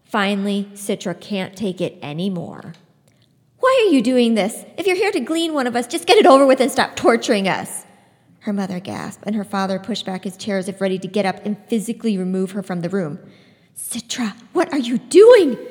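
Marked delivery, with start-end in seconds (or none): 3.6-7.9 loud, fast, furious demand; angry outburst. Acted. Citra yelling at the scythe, angry and loud. 13.8-15.6 loud alarmed cry; a frightened mother scolding her daughter; shocked mother. Acted. Mother crying out at Citra, loud and alarmed. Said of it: "her mother's voice was quivering".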